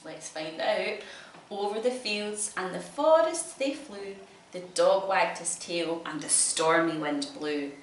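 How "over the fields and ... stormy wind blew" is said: loud, lively read-aloud; reading to children. A narrator's reading. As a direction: perform the rhymes for a child. Reading picture book aloud.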